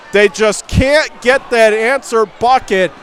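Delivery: ordinary delivery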